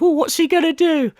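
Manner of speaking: high, sarcastic faux wonder; mock suspense. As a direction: pretend you can't guess what's coming. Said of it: "supposed to be in suspense of".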